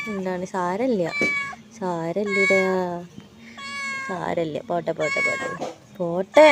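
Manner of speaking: soft, slow hushing to a scared kitten; quietly calm the frightened cat; there, there, softly and slowly; soothing hush. Real, not acted.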